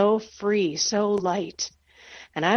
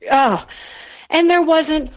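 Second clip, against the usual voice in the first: exasperated disbelief; 9 semitones higher, 6 dB louder, slower than usual. Real, not acted. No tissues while sobbing.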